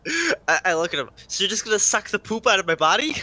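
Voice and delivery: laughing through the words; can barely keep a straight face; laughing disbelief. Acted. Player laughs through in-character disbelief.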